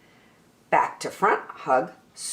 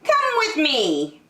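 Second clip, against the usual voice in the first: kindly rabbit; 10 semitones higher, 3 dB louder than usual. Acted.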